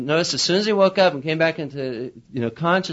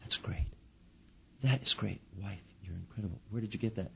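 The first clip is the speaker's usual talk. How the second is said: hushed, almost whispered wonder; soft awed husband; 6 semitones lower, 14 dB quieter than usual. Retold or imitated. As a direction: breathe it out in amazement. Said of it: "he goes".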